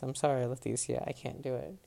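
ordinary delivery

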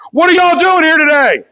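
high, full-volume shout; shouted rally call. Acted. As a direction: yell it so the whole town hears. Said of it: "see if they can hear us across the town".

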